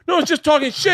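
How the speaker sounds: high-pitched imitation of backing out; backpedaling protest. Comic trying to get out of a fist fight, protesting.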